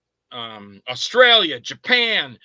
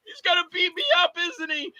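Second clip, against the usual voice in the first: high, frightened acted whimper; cowardly whimper; 12 semitones higher than usual. Coward on the couch to the girlfriend, whimpering fear. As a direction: seek reassurance in a tiny scared voice. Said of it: "I played the total opposite".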